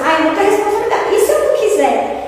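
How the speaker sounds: high, fast whiny sigh; mock a reluctant sulk; mocking complaint. Speaker imitates the misquoted Kardec, whining mockingly. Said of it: "Aquela coisa assim".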